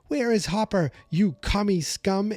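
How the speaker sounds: high, stagy accusation with no extra volume; rehearsed defiant demand. Retold or imitated. Gagged prisoner demanding answers of the warden, rehearsed mock defiance. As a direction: deliver it like a memorized line of defiance. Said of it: "it was one hundred percent rehearsed".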